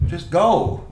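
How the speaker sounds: high, loud, drawn-out go; fed up. Retold or imitated. Coach finally shooing the parent away, loud and fed up. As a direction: throw up your hands and send him off. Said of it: "I'm like".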